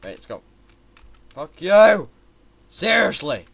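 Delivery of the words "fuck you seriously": sudden furious outburst at the game; angry yell. Real, not acted. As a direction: snap it out in a rage. Raging at the game.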